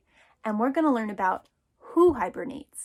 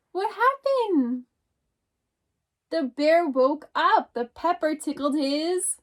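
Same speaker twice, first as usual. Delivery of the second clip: a little mouse calling out, startled; 3 semitones higher, slower than usual. Acted. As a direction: call out as if someone just knocked. Teacher reads picture book aloud.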